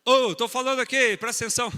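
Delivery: loud, high angry yell at the crowd; violent shouting. Shown as a demonstration. Spouse showing violent speech yells at partner angrily. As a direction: bark it like a furious spouse demanding attention. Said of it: "Quer ver?".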